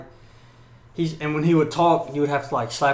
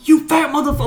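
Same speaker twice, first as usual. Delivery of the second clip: high, loud mock berating; going in on him; 12 semitones higher, 8 dB louder, faster than usual. Drill instructor tears into the laughing recruit, mock furious yell. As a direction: tear into the recruit, imitating an irate instructor. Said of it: "going in on his ass".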